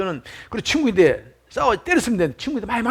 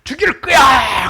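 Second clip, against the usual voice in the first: angry cursing; 3 semitones higher, slower than usual. Retold or imitated. Young boy curses his friend from a hilltop, acted angry yell. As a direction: hurl the curse across the hills, acting it out. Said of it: "욕을 합니다".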